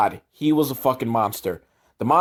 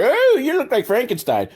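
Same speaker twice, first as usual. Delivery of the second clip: higher, mock-snotty kid voice; annoying kid; 9 semitones higher than usual. Podcaster mocks the annoying kid in the film, high and snotty. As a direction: imitate a smug, annoying British boy. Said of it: "he starts being, like, annoying".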